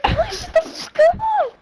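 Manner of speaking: very high, squealed plea; laughing retold hushing. Telling Emily to shut up, retold through laughter.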